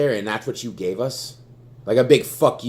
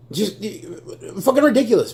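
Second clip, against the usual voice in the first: exasperated outburst; 11 semitones higher than usual. Real, not acted. Fan fed up with the team, high exasperated outburst. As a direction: throw up your hands in disbelief.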